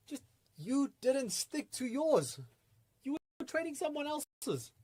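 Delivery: high-pitched, drawn-out reproach; exasperated scolding. Acted. Scolds the imagined trader.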